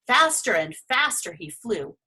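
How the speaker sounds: ordinary delivery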